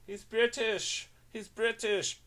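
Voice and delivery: prim, squeaky fake British accent; mock British accent. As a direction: echo the bad British impression, high and drawn out. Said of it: "That's your British?".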